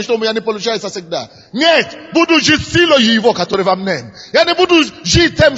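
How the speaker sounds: ordinary talk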